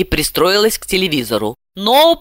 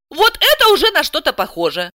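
high-pitched pleased exclamation; delighted; 10 semitones higher than usual. A narrator's reading. Housewife finally hearing something useful, delighted. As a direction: brighten at the useful news. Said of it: "Александра обрадовалась".

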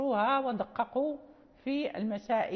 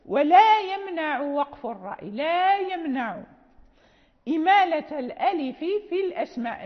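emphatic verse reading; 2 semitones higher, 6 dB louder than usual. Shown as a demonstration. Teacher re-reading the verse loudly to explain it, emphatic. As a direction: drive the verse home word by word.

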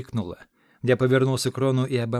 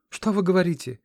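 higher, louder outraged protest; indignant; 7 semitones higher, 5 dB louder than usual. A narrator's reading. Dayana protesting Ron's talk of death, indignant. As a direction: push back at his words. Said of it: "возмутилась Даяна".

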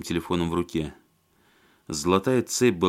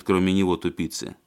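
ordinary delivery

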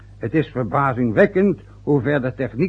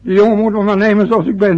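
higher and louder, heated; agitated outburst; 8 semitones higher, 7 dB louder than usual. Acted. Father bursting out about his son to his wife. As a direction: let it burst out of him. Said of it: "Is de man zijn dood als die zo doorgaat?".

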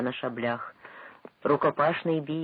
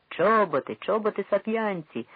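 1 crowd reply; 7 semitones higher, 4 dB louder than usual. A narrator's reading. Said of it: "відповіли деякі".

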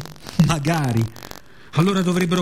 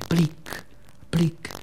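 raindrop plic; 6 dB quieter than usual. Acted. Imitating raindrops on window.

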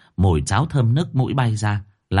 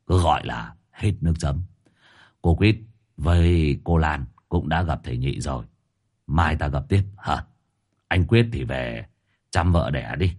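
pompous sage; 7 semitones lower than usual. A narrator's reading. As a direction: speak lower like a grand master dismissing all. Tam Vô speaks like a sage. (giọng thầy trầm, thấp, ra vẻ bậc cao nhân)

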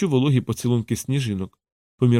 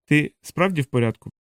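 ordinary delivery

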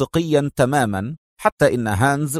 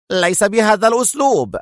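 high, sharp objection; sharp retort; 8 semitones higher than usual. A narrator's reading. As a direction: object sharply, pitch jumping up. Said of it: "قال هانز في حدة". (بحدة)

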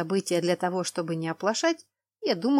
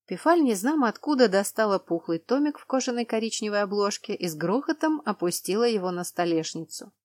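plain reading voice

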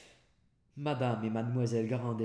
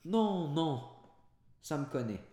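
calling back; 6 semitones higher, 4 dB louder than usual. A narrator's reading. Master calls back from stairs.

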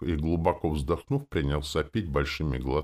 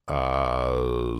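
drawn-out, hesitant filler; thoughtful; 3 semitones lower than usual. A narrator's reading. Coachman ponders a deal with passenger, thoughtful. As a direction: stall while thinking it over. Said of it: "задумчиво".

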